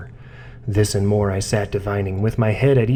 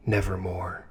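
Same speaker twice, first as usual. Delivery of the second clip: raven's reply; 2 semitones lower, 10 dB quieter than usual. Acted. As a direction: drop it quiet and final like the bird. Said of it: "Quoth the raven".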